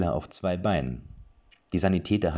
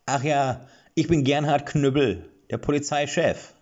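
higher, louder dwarf voice; dwarf introducing himself; 9 semitones higher, 6 dB louder than usual. A narrator's reading. Police chief dwarf names himself, higher and louder. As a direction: turn back with a wink.

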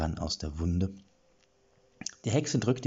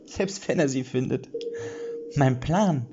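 higher witch voice, ending in a hiss; gloating witch; 6 semitones higher than usual. Acted. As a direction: savour the perfect plan. Witch gloats over her plan. (selbstgefällige Hexe)

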